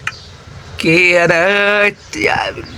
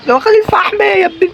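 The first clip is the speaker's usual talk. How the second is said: indignant yelp; 13 semitones higher, faster than usual. Real, not acted. Monster stole his coal.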